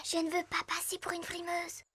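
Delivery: whispered, shy aside; a bashful little whisper; embarrassed whisper. Acted. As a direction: hush it so no one else hears. Shy aside while being urged on.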